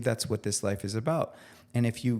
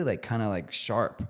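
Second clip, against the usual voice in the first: ordinary talk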